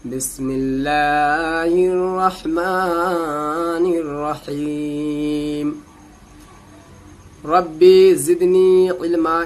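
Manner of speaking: slow, drawn-out tajweed chant; Quranic recitation. Acted. Teacher opening the lesson, slowly reciting the invocations. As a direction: intone each word long and measured.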